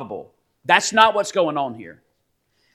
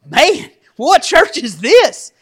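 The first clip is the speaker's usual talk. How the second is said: much higher, louder, slow mock alarm; shocked inner thought; 12 semitones higher, 11 dB louder, slower than usual. Pastor recalling his shock at the satire article, high mock alarm. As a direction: gasp it out in disbelief. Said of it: "And I thought".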